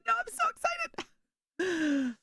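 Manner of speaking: mock-gushing no I'm so excited; rocketing up on so, then settling; squealing excitement. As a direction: squeal the so very high. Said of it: "And I'm like".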